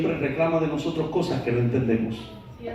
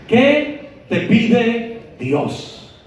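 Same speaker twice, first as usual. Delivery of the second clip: higher, very slow, stretching each word of ¿Qué te pide Dios?; drawn-out, searching ¿Qué te pide Dios?; 6 semitones higher, 3 dB louder, much slower than usual. Shown as a demonstration. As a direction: pull ¿Qué te pide Dios? out slowly, pressing the question.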